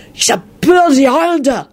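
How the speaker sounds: high, slurry warning; warning hiss. A narrator's reading. Friend warning the drunken singer, hissing. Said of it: "Victor hissed warningly".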